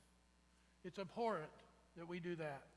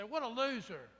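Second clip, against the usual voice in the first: mocking crowd; 4 semitones higher, 8 dB louder than usual.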